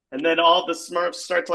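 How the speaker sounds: ordinary delivery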